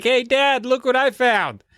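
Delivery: put-on high, cheerful little girl voice; eager kid. Kat shows her dad the sponge, eager and high. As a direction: show it off proudly. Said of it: "She's like".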